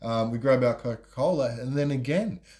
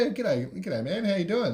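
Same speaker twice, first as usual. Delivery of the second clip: shocked reply; 7 semitones higher than usual. Retold or imitated. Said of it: "I'm in a bit of shock".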